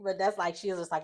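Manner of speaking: usual speaking voice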